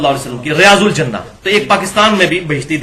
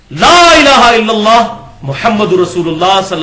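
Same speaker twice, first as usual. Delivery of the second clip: solemn recitation; 3 semitones higher, 4 dB louder, slower than usual. Real, not acted. Reciting the true creed.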